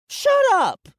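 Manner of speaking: put-on high, loud child's shriek; yelled. A narrator's reading. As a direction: yell it at him, shrill and sudden. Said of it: "she yelled at me".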